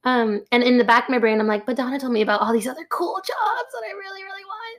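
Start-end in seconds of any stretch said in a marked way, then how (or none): 1.7-4.8 high, trailing-off inner whine. Retold or imitated. New hire privately longing for the other jobs, whiny. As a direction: plead it high and fast, fading away. Retold wistful inner protest.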